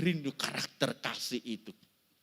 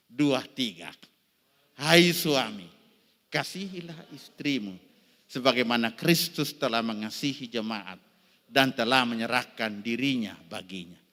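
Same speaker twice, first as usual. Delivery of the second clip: loud group reading; 2 semitones lower, 9 dB louder than usual. Acted. Congregation reads verse aloud.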